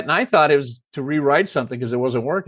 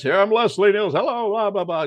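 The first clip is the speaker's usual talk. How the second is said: lightly imitated show opening, pitched up; higher, sketched-in host patter; Leslie Nielsen; 6 semitones higher than usual. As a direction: open the show with a quick mock host greeting. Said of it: "The show starts".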